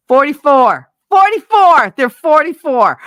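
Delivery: loud, high mock exclaim of the number; hammering the size home, loud and high. Telling ex-husband his real size, loud and high.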